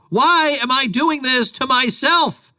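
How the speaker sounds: high, slow despairing yell; anguished shout. Real, not acted. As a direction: cry out to the sky, at wit's end.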